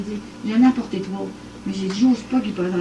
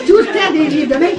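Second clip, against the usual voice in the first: ordinary talk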